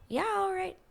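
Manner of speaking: high, strained imitation of an awkward guy; awkward mumble. Mimicking a contestant's awkward reply to his date, high and strained. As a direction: squeak it out like he's mortified.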